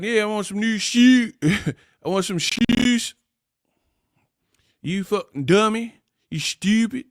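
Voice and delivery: high, hammed-up Baltimore accent. Host mimicking how Baltimore locals talk, high mock Baltimore accent. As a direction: pitch it up and stretch the Baltimore "oo". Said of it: "Why y'all talk like that?".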